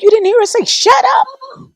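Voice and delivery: incredulous. Retold or imitated. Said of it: "everyone was like".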